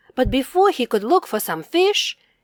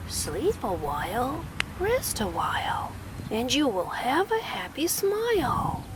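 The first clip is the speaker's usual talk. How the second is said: softer chanted lullaby; soft sing-song rhyme; 8 dB quieter than usual. Acted. Tiny bear repeating the kittens' rhyme, softly chanted. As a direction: croon the rhyme gently.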